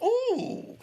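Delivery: high squeal of disgust at the needle; a sharp, high-pitched ooh, recoiling; squeamish ooh. Retold or imitated. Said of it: "So I'm like".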